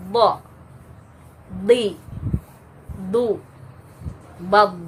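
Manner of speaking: heavy full-mouth bo di du bab; isti'la demo, loud and thick; thick heavy letter demo. Shown as a demonstration. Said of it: "tebal bunyinya".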